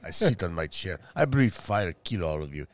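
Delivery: put-on low Russian-accented dragon; mock Russian accent. Dragon threatening all from his chair, mock Russian accent. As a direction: menace them in a deep, joking Russian accent. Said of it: "I think the dragon was Russian".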